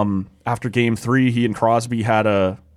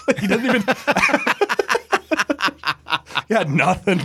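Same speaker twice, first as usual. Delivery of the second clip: laughing; 9 semitones higher, faster than usual. Real, not acted. Laughing at story.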